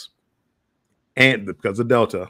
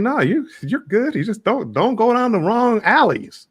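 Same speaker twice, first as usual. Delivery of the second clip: high-pitched, sing-song reassurance; retold reassurance; 9 semitones higher than usual. Traveler telling frightened Australians they will be fine, high reassurance. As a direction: talk them round in a lifted, drawn-out voice.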